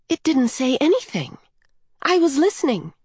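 put-on high-pitched child's protest; indignant child. A narrator's reading. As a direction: contradict her in a high young voice. Child indignantly contradicts Coyote.

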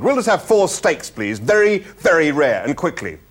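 brisk, impatient ordering; snapping. A narrator's reading. Zaphod ordering four steaks from the animal, snapping. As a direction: order forcefully, starting high. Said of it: "snapped Zaphod".

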